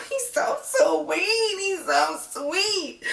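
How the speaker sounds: high, slow, tearful; moved almost to tears over the dad; choked up. Real, not acted. Viewer fighting tears over the bride's dad, high and slow. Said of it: "this mother gonna make me cry every time".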